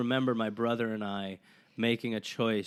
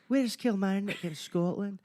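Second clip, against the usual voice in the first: put-on much higher Scottish accent for the local's question; Scottish man; 9 semitones higher than usual. Guest imitating a Scottish man questioning fake Scots, Scottish accent. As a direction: play the real Scot, curious and testing, in a Scottish accent. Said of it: "a Scottish person".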